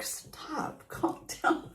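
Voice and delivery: hushed, breathy stop to the dog; whispered hush. Retold or imitated. Whispering to the excited dog to calm down.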